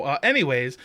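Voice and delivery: usual speaking voice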